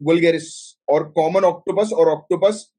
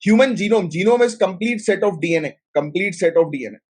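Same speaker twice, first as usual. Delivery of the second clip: dictation pace; much slower than usual. Shown as a demonstration. Dictating the definition to students.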